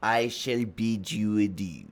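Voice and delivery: slow, grandly stretched names; terrible boy impression. The boy addresses the rest of the party, slow drawn-out impression. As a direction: draw out every word theatrically. Game master plays the boy.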